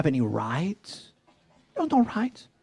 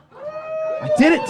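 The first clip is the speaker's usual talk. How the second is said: very high squeak; squeaky denial. Retold or imitated. Telling the audience he didn't scream, squeaky. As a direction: let the voice crack up high.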